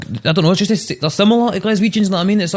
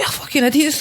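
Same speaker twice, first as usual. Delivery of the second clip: mock angry retort; 12 semitones higher, 4 dB quieter than usual. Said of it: "I was like".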